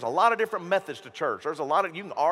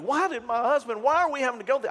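high, louder anguished questioning; Linda; 7 semitones higher, 3 dB louder, faster than usual. Retold or imitated. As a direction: plead why, pitched up in mild distress. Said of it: "think of Linda and all that she's gone through".

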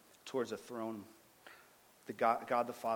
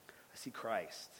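slow, near-whispered reveal; hushed awe; 7 dB quieter, slower than usual. Real, not acted. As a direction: let it out softly, in awe. Awed climax of vision story.

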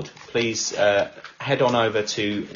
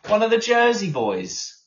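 excited; 11 semitones higher, 4 dB louder than usual. Real, not acted. Excited about listener's home.

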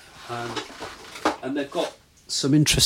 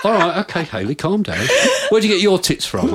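laughing; 10 semitones higher, 4 dB louder than usual. Retold or imitated. Calming friend, laughing.

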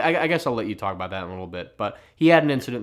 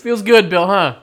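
loud teasing; 9 semitones higher, 13 dB louder, slower than usual. Real, not acted. Teasing partner loudly.

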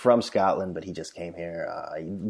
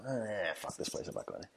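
muttered brush-off; 15 dB quieter than usual. Retold or imitated. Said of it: "peek in and go".

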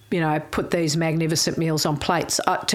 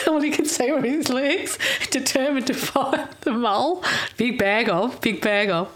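1 laughing telling; 6 semitones higher than usual. Real, not acted. Laughing at Gary in dumpster.

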